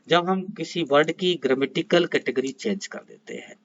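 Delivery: ordinary talk, nothing marked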